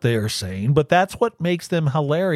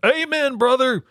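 higher hammed-up wrestler call; wrestler impression; 9 semitones higher than usual. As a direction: Hulk Hogan style catchphrase. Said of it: "Is that a Hulk Hogan".

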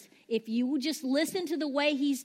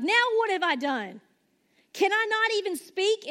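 put-on higher, put-upon baby of the family; whiny youngest sibling; 7 semitones higher, 3 dB louder than usual. David protesting his brother's rebuke, whiny. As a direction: whine like the picked-on little brother. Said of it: "something the baby of the family would say".

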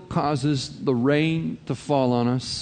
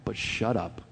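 softer aside; 7 dB quieter than usual. Retold or imitated. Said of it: "looking at him and saying".

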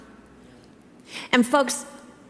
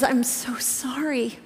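gentle sympathy; 5 dB quieter, slower than usual. Retold or imitated. Comforting a shaken young colleague.

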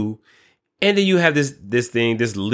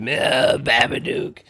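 creepy Babadook voice; slower than usual. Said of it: "that's how Mister Babadook".

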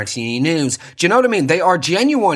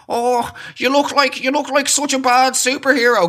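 mocking jeer; 7 semitones higher than usual. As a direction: imitate a heckler jeering. Mocking passers-by' insults.

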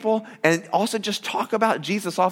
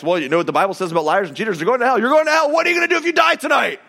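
Kirk Cameron street preaching; 4 semitones higher, faster than usual. Retold or imitated. Street evangelist confronting a stranger, building to a shout. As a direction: start pushy, then shout the warning fast. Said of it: "he'd be like".

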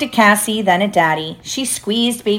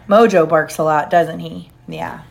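usual speaking voice

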